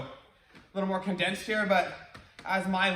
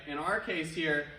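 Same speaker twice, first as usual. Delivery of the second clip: ordinary delivery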